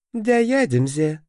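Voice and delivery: high, slow mocking parody; mocking mimicry. A narrator's reading. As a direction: mimic him to mock him. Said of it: "передразнила его Мелисса".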